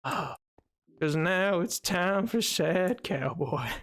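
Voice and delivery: put-on slow, mournful cowboy accent; sad cowboy. Streamer announcing a redeemed accent, sad cowboy voice. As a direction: drag it out like a sorry cowboy. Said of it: "Not the sad cowboy voice".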